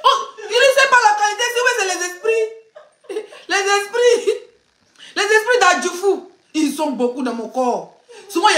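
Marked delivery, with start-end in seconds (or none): 0.0-6.3 laughing while telling it. Real, not acted. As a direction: talk through real laughter. Laughing about the spirits.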